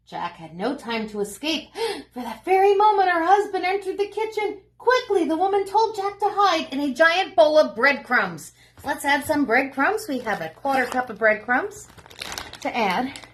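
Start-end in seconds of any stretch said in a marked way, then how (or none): 0.1-8.5 story narration. A narrator's reading. Reads story to children.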